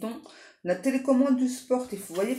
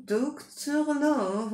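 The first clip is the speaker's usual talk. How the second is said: higher, slow, stretched out Docteur Love; drawn-out playful; 6 semitones higher, much slower than usual. Acted. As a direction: savour the name Docteur Love like a tease. Repeats card name drawn out.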